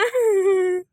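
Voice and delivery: long, drawn-out teasing laugh. Acted. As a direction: laugh at your own jibe. Laughs after calling mother old. (चिढ़ाती हुई हँसी)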